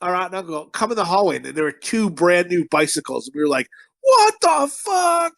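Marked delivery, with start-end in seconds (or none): 4.0-5.3 high, drawn-out amazed cry; excited disbelief. Retold or imitated. Kid seeing a brand new bicycle, retold amazed excitement. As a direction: stretch it out high, re-enacting a thrilled kid. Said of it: "how excited were you when you seen that bicycle".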